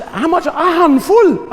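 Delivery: high-pitched questioning, building to a loud drawn-out answer; exasperated exchange with mum. Retold or imitated. Comic retelling of asking mum about measures, exasperated. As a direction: act out a squeaky, exasperated family exchange. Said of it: "and I said".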